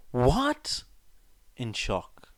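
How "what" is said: loud, high shocked "What?"; shocked yell. Acted. Boy realizing his grandpa is the first Hokage, shocked yell. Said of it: "he yells".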